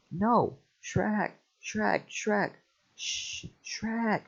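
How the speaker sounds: high, frantic calls for Shrek; panicked calling. Acted. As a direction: yell for help in a hushed panic. Donkey calls for help.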